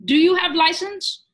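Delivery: put-on high, loud, rising demand from a pushy old man; imitating a pushy uncle's high, loud challenge; angry uncle demanding. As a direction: bark the question at the rider like a stranger with no right to ask. Stranger uncle confronting on road.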